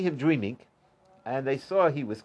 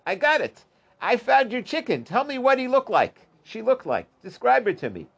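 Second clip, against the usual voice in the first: higher-pitched, eager mock discovery; excited retelling; 7 semitones higher than usual. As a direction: act out a eureka moment, pitch lifted. Rabbi excitedly identifies lost chicken's owner.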